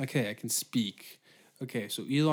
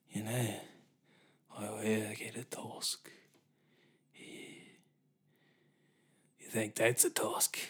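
soft, halting put-on voice; hushed, hesitant caricature; mock Elon Musk; 3 dB quieter than usual. Imagined Elon talking about tusk.

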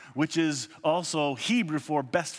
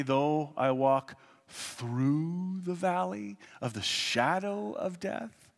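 slow, reverent psalm recitation; solemn scripture reading; 3 semitones higher, slower than usual. Acted. Reads King James psalm aloud.

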